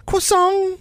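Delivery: squeaky-high commercial "croissant"; high-pitched voice. Retold or imitated. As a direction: chirp it far above your own voice. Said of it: "There's a high-pitched voice".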